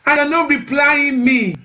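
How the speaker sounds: ordinary talk, nothing marked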